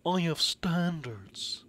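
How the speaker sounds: slow, breathy prissy refusal, trailing into a whisper; snooty character. Pretend. Character refusing to drink from a sink, mock snooty. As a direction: play a picky snob turning up his nose. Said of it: "My character's like".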